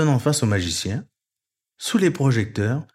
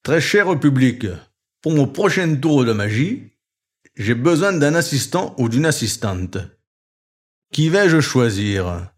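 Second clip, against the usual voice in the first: showman magician; 2 semitones higher, 3 dB louder than usual. A narrator's reading. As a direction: tease the audience like a showman fox. Fox magician addresses crowd.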